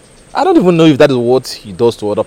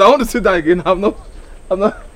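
usual speaking voice

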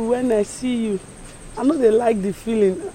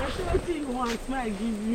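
shy; 11 dB quieter than usual. Acted. Shy woman asks quietly.

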